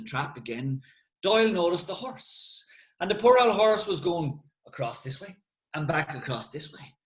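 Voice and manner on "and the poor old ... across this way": plain reading voice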